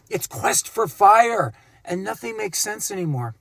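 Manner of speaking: ordinary talk, nothing marked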